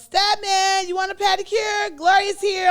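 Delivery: high, loud holler to someone nearby; calling out. Retold or imitated. Acting Oprah calling Stedman over, a loud high holler.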